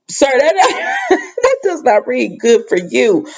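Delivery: high, drawn-out words through laughter; laughing in disbelief. Real, not acted. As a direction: crack up while scolding him. Laughing at the retold line.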